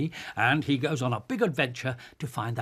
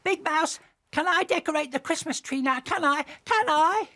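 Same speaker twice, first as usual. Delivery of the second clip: put-on high, fast, pleading child voice; eager little mouse; 14 semitones higher than usual. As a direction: beg to decorate the tree.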